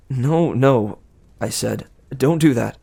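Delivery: ordinary delivery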